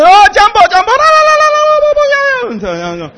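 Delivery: high, loud Jumbo calls into fast trilling; imitating cheering crowd. Preacher imitating the African congregation cheering back, high and loud. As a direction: mimic the crowd's joyful shout and ululation. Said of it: "所以台下就".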